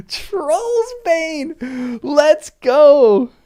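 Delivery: high, loud hype shout; hyped-up fan yelling for Pippin's sword; excited cheer. Real, not acted. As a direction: cheer it like a fan at a big moment.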